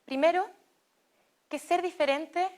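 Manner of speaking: ordinary talk, nothing marked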